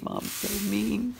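slow, sulky pouty puppy complaint; dog's sulky voice. Pretend. Mom voices her dog sulking about medicine, slow and pouty. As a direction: whine it like a wronged puppy. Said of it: "Oh, he's like".

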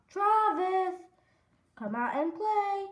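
high, loud singsong call; hollering. Acted. Froggy calling at Max's house, high holler. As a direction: holler for a friend to come out.

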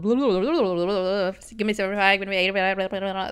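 rapid-fire tongue-flapping gabble; mock auctioneer patter. Imitating people who work in the auctions, rapid-fire gabble. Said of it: "that work in the auctions".

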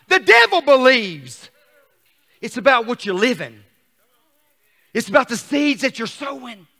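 punchy, fired-up preaching; forceful preaching. Real, not acted. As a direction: drive each phrase home to the congregation.

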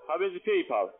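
ordinary talk, nothing marked

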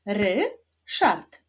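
"Ryszard" as RY... SZARD, two separate beats; "Ryszard" split, rising ry then falling szard; syllable by syllable. Shown as a demonstration. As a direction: clap out "Ryszard" with a pause after ry. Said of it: "Wyklaszczmy sylaby w imieniu Ryszard".

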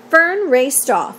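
usual speaking voice

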